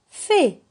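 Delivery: "fait" as a lone "fé", high and loud; isolated syllable. Shown as a demonstration. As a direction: sound out the syllable "fé". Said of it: "La deuxième syllabe".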